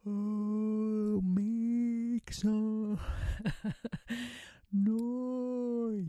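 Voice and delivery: high, stretched sound-making with a laugh; vocal warm-up. Shown as a demonstration. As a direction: loosen up and let the voice out. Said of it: "It sounds like this".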